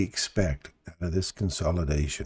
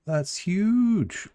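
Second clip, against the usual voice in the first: surprised; 5 dB louder than usual. Real, not acted. Surprised exclamation at chart.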